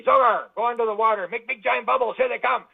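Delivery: hammed-up alien boss barking a plan; alien orders. Aliens voiced giving orders.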